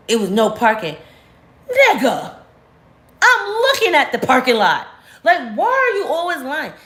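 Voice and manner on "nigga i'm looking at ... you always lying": high-pitched exasperated call-out; indignant outburst. Retold or imitated. Boyfriend lying about no parking, high exasperated call-out. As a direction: pitch way up, catching him in a lie. Calling out ex's lie.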